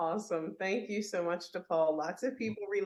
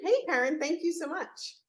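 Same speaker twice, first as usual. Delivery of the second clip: bright, high welcome to the guest; excited greeting; 10 semitones higher, 4 dB louder than usual. Real, not acted. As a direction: greet her with a big lift. Host greets guest warmly.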